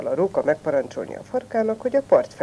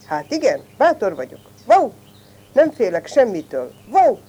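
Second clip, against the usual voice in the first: mildly raised, boastful storybook voice; full-throated boast; 5 semitones higher, 6 dB louder than usual. A narrator's reading. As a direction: crow about being brave, with punchy barks. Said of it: "Most már teli torokból harsogta".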